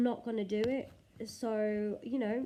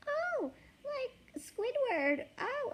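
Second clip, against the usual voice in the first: squeaky very high cartoon sponge; SpongeBob voice; much slower than usual. Vlogger trying SpongeBob, overshooting into a high squeak. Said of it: "SpongeBob would be like".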